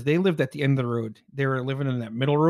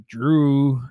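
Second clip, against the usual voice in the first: creepy Pet Sematary call; much slower than usual. Retold or imitated. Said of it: "from Pet Sematary".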